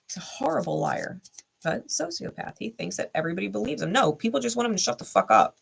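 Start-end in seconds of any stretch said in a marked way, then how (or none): 0.1-1.2 "He's a horrible liar" whispered with no voice. Real, not acted. Whispering her verdict on the ex to the viewers. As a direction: hush it, breath only.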